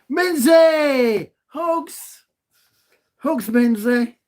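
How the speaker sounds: high, loud, bursting hello; excited greeting. Real, not acted. Streamer greeting viewer Minzy in chat, high excited shout. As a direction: shout the name out high with joy.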